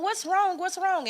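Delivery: high, insistent repeated question; worried dad. Retold or imitated. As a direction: keep pressing, voice up high. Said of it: "he just kept saying".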